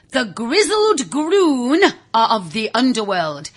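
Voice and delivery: slow, looming narrator voice; ominous storytelling. A narrator's reading. Narrator opens the tale of the Groon, slow and ominous. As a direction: lean in, slow and dark.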